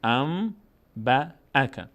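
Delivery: ام با اک in separate syllables, held m; n turned to m. Shown as a demonstration. Teacher models the rule, ام با اک syllable by syllable. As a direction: hum ام, then با, then اک.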